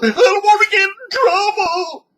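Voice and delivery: loud, very high hammed-up crying; exaggerated high sobbing refusal; mock wailing. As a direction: wail like an overgrown crybaby. Whining refusal in a skit.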